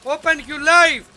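loud urgent calling out; shouting for a revive; man down shout. Real, not acted. Teammate down, calling for Lifeline.

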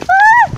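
high, loud yelp; short high shriek; yelp on falling. Real, not acted. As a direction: cry out sharply as you fall. Falls during front flip.

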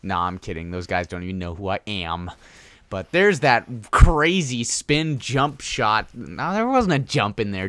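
ordinary delivery

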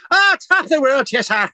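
put-on high, loud stage-Irish accent with laughter; hammed-up Irish cheer, laughing heartily; mock Irish accent. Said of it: "people in Ireland would actually hate me".

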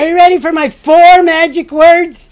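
high, loud stage magician; magician's voice. Pretend. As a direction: announce it like a showman. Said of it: "find my magician's voice".

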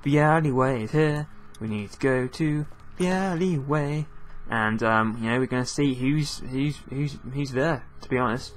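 usual speaking voice

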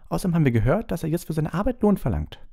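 put-on high-pitched, arguing girl's voice; Hermine, insistent. Hermine argues with Hagrid about elves, insistent.

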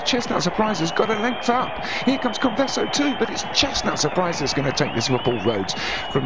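excited finish call. Acted. Commentator calling the winner past the post, soaring excited call. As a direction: scream the challenger home as he passes. Photo finish, late challenger.

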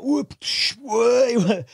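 high, sudden whoa; startled whoops as the weather turns; mock surprise. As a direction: yelp as the storm hits.

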